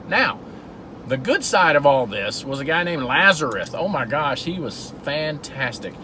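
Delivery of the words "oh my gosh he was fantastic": ordinary talk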